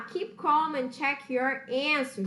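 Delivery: slow, deliberate English phrase; slow English line. Shown as a demonstration. Teacher says an English phrase for students, slow and clear. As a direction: spell it out slowly for learners. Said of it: "Então, se acalme".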